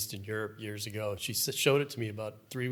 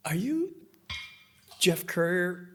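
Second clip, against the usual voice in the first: high, halting question with long pauses; disbelieving, pitched well up, word by word; tentative recognition; 7 semitones higher, 4 dB louder, slower than usual. Retold or imitated. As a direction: imitate a starstruck young guide. Retold young guide tentatively recognizes him.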